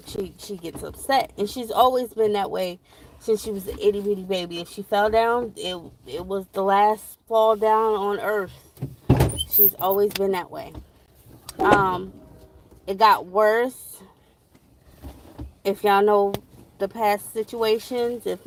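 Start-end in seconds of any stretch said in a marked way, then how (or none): none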